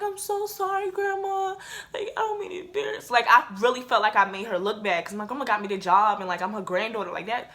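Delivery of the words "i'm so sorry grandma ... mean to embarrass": high, small mock-weepy voice; squeaky, whimpering sorry; tearful apology. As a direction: imitate your own crying apology, pitched way up. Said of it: "I started crying".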